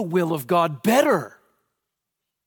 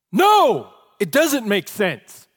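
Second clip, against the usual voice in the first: a burst of a shouted no, then slower; sudden loud no; 4 semitones higher, 7 dB louder, slower than usual. Acted. Preacher rebuts the congregation, sudden loud no. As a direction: break the hush with it.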